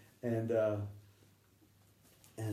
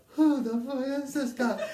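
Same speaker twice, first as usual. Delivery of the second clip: incredulous gunmen; 13 semitones higher, 5 dB louder than usual. Retold or imitated. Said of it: "And they're like".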